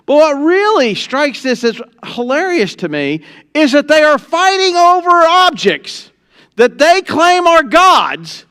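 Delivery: high, loud, driving sermon voice; full-force preaching. Acted. Preacher rousing the congregation, high and loud. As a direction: preach it hard to the church.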